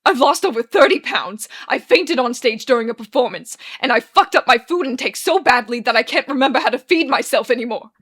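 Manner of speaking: loud, unrestrained outpouring; distraught wailing. Acted. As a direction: pour it all out at full volume. Arthur's wails ricochet.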